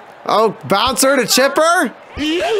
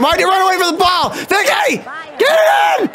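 high, frantic shouts at teammates; real frustrated gamer yell; exasperated yelling; 7 semitones higher than usual. Real, not acted. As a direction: scream at the fielder to hurry. Yelling at fielders botching the play.

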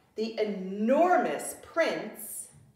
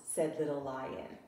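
ordinary delivery, nothing marked

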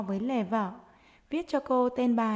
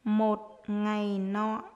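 dictating; slower than usual. Shown as a demonstration. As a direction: dictate word by word to young pupils. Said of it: "hãy nghe cô giáo đọc để chúng mình viết".

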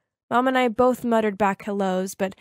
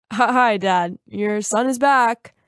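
male voice; 4 dB louder, much slower than usual. Acted. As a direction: fake a man's voice, slowly. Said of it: "then in a male voice said".

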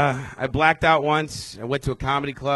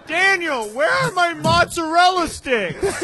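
much higher, slow, laughing demand; whiny customer; 13 semitones higher, 5 dB louder, much slower than usual. Retold or imitated. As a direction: play a needy diner whining for food.

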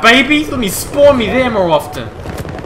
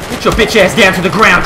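normal talking voice

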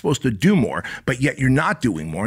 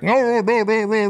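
high-pitched gagged mumble; muffled mumbling; 11 semitones higher than usual. Acted. Inner advocate heard from the trunk, muffled mumbling. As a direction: mumble it high, as if through a taped mouth. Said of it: "you hear this, like, mumbling".

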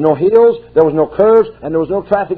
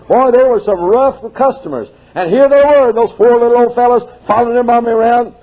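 animated; 7 semitones higher than usual. A narrator's reading. Animated telling of the boys.

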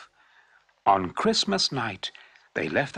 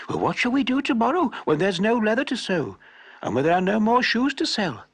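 higher, anxious wifely voice; worried wife; 9 semitones higher, 3 dB louder than usual. A narrator's reading. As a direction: fret about tomorrow.

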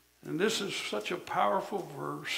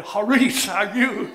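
mock greeting; 3 semitones higher, 11 dB louder than usual. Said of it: "walk up to them and say".

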